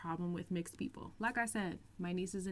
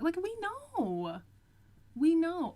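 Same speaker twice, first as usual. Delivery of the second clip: higher, slow sassy repeat; sassy mock; 9 semitones higher, 4 dB louder, slower than usual. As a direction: drag it out with sass. Mocking the quoted viewer.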